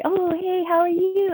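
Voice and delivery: high, drawn-out delighted greeting; happy. Shown as a demonstration. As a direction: greet them with a big happy lift in pitch. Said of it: "I'm happy".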